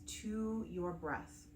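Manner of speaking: calm meditation guide with long pauses between phrases; unhurried, soothing breathwork instruction; guided meditation. Acted. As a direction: gently lead them through the breathing, leaving space after each phrase.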